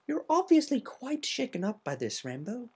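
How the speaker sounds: a polite lady explaining gently; Rarity voice. Acted. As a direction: elegant Rarity, soft and proper.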